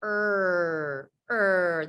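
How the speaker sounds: a drawn-out er with the tongue floating; R sound. Shown as a demonstration. Teacher modelling the R sound for learners, a long er. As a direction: growl a long er. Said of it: "R says".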